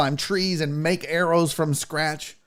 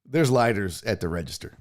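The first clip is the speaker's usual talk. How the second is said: much lower, softer dry reply; deadpan clerk; 9 semitones lower, 4 dB quieter than usual. Retold or imitated. Store clerk tiredly answering the tribesman, low and soft. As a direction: flatly point him to the register.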